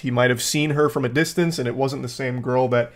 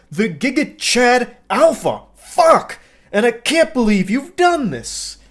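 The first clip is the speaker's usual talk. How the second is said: mock furious rant; 9 semitones higher than usual. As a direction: blow up like the self-styled alpha. Acted rant of angry date.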